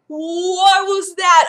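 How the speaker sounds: high, loud, slow startled cry; panicked alarm. Retold or imitated. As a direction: jolt of panic at a scraping noise. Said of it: "I start panicking".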